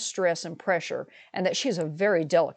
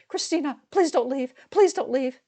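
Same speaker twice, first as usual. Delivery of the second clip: high, begging mother voice; pleading; 8 semitones higher than usual. Retold or imitated. Mother begs the sister-in-law not to leave, pleading. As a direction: beg her not to go, pitched up.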